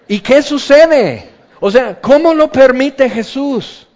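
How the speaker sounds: high, slow indignant question; mock outrage. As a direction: sound scandalized at the intrusion. Preacher mocking the indignation. (indignación fingida, alta y lenta)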